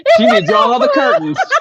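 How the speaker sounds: words through laughter; laughing hard mid-line. Real, not acted. Laughing while speaking.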